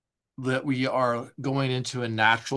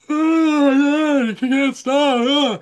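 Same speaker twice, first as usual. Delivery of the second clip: much higher, louder, slow strained whine; mock overwhelmed yawner; 9 dB louder, much slower than usual. Therapist imitating a client overwhelmed by yawns, high and strained. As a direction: stretch it out like a yawn you can't stop.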